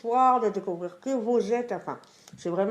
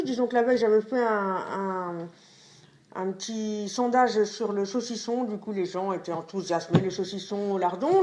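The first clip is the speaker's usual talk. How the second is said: ordinary delivery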